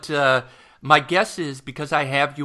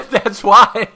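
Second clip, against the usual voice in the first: laughing out loud; 7 semitones higher, 10 dB louder than usual. Real, not acted. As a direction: burst out laughing at the joke. Laughs at guest's joke.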